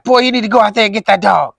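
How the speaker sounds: impatient dad ordering his son outside; furious dad. Retold or imitated. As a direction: bark the order like an angry father woken into action. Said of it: "My dad was furious".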